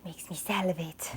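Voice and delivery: breathy whisper of craving. Real, not acted. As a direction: whisper it, mouth watering. Savouring the sauce while cooking.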